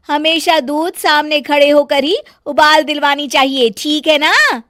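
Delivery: high, loud complaining bahu voice; bossy bahu fussing over nothing; nagging daughter-in-law. Acted. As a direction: scold the whole family, shrill with irritation. Megha lectures Sonam.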